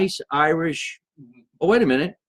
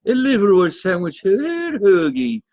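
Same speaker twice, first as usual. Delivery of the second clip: slow, stretched Irish brogue, a touch higher; Irish accent; 6 semitones higher, 4 dB louder, slower than usual. Acted. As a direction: try an Irish accent and drag hoagie out slowly. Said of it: "I can't even do the accent".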